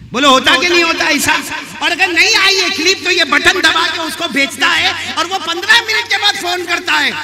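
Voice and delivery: full-force preaching. Real, not acted. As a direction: press the congregation with the accusation. (ऊँची आवाज़ में जोशीली तक़रीर)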